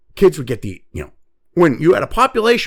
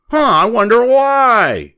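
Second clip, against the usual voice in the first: sarcastic; 3 semitones higher, 5 dB louder, slower than usual. Real, not acted. Sarcastic aside after claim.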